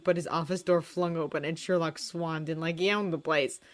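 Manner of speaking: usual speaking voice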